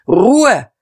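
commanding order. A narrator's reading. Old Buck quelling a brawl, commanding shout. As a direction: command the hall from the stage. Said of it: "hörte man von der Bühne herab befehlen".

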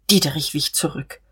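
plain reading voice

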